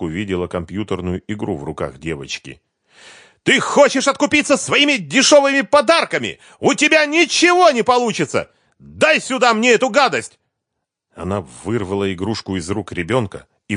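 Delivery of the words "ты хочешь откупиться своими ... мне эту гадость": very high, loud scornful yell; enraged screaming. A narrator's reading. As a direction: shriek the accusation, then demand it. Wife screams, seizing the toy.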